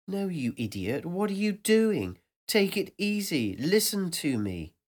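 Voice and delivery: a sharp, high rebuke that settles into calming him; scolding inner voice. Acted. Inner voice scolds narrator for rushing the steps, high and exasperated.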